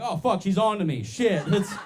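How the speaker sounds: high, loud, hammed-up alarm; mock panic. Fake-accented driver caught by blind passenger, mock panic. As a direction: blurt it out like you've been rumbled. Acting out panic at being found out.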